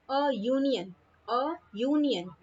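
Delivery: "union" opened with a य glide; "A" as open अ, then "union" as yu-nion; y-sound union. Shown as a demonstration. Teacher demonstrating consonant sound, "union" starting with य. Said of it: "य की sound आ रही है".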